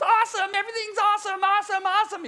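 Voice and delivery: high, gushing parody of a fad word; irritatingly high mock enthusiasm; mocking overuse. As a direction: chirp it high, mimicking people who overuse it. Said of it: "It was so irritating me".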